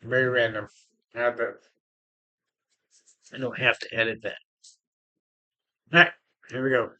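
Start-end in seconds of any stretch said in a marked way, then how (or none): none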